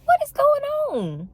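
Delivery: very high, slow squeaky disbelief; shocked disbelief. Retold or imitated. Retold reaction, high squeak.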